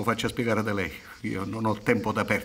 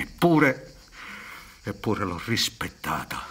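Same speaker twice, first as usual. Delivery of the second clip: bitter lament; 4 semitones lower, 6 dB quieter than usual. Acted.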